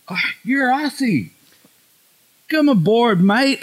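put-on high, delighted American accent; American bus driver. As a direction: put on an eager American driver welcoming an Aussie. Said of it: "bus in L A once".

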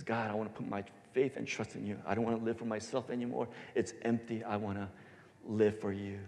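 soft, quick heartfelt prayer, words tumbling out; quiet prayer. Acted. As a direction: pray it softly, as if alone with God. New believer praying to God.

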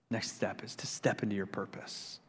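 hushed, breathy whisper. Real, not acted. Pastor whispering the next step to the church. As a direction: lean in and whisper it. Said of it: "whispered point".

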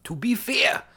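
high-pitched, exaggerated Geordie; attempted Geordie accent. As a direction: put on a comically bad Geordie accent. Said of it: "trying to do a Geordie accent".

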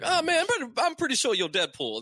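high-pitched mock confusion; lightly voiced mistaken onlooker. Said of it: "Yeah, like".

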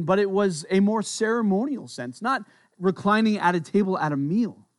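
ordinary talk

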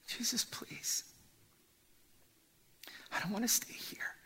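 begging Jesus to take him along, whispered; anguished whispered plea. Acted. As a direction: plead in a broken whisper.

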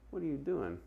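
high, quiet disapproving question; judging side-eye. Acted. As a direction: question them softly with a judgmental side-eye. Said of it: "gave you the little side eye".

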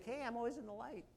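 mock someone pretending all is well; fake cheer. Churchgoer claiming to be always in the light, fake. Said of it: "not to be fake".

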